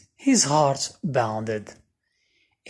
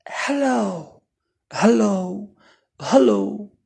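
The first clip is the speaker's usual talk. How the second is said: higher soft calling out; calling softly; 7 semitones higher than usual. A narrator's reading. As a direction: call out gently into the dark. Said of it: "Oliver called softly".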